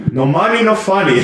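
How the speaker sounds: high-pitched mock Portuguese accent, amused. Quoting the handyman who laughs at his own jokes, Portuguese accent. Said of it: "He says it in, like, an accent".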